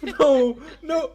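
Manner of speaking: high, loud incredulous laugh; can't believe it, laughing; laughing disbelief. Real, not acted.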